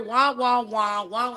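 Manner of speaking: muffled wah wah drone; Charlie Brown teacher. Retold or imitated. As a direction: imitate the trombone teacher noise. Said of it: "sounds like Charlie Brown, the teacher".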